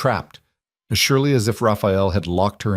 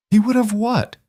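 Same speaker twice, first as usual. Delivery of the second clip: much higher, incredulous bitter demand; bitter challenge; 14 semitones higher than usual. Acted. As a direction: throw the words back at her. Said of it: "Isabella laughed bitterly".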